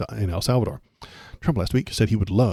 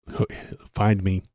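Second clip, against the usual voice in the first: ordinary delivery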